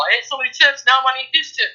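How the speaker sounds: high-pitched imitation of eager food thoughts; frantic inner voice. Client mimicking her old thoughts about chips, high frantic inner voice. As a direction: act out a nagging craving, pitched well up.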